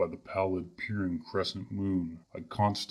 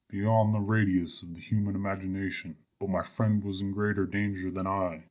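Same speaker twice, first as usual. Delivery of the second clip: ordinary delivery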